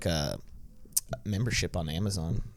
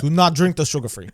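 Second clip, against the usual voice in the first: mock Dutch accent, louder, pushed higher; bad Verstappen accent; 5 semitones higher, 8 dB louder than usual. Warning the co-host off sugar free, bad Dutch accent. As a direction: ham up a Dutch racer's warning in a clumsy Dutch accent. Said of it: "that was a terrible accent".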